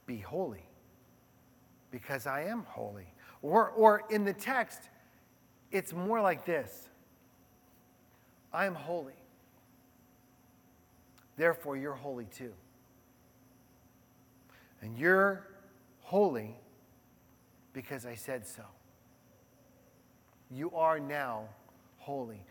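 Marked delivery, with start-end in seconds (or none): 0.1-3.1 hushed voice of God. Acted. Pastor gives God's words to the unsure listener, hushed. 8.5-22.4 soft divine declaration. Acted. God's declaration, soft and low.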